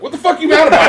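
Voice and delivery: high, loud laughing outburst; laughing disbelief. Real, not acted. Coworker laughing in disbelief at his sad winning friend.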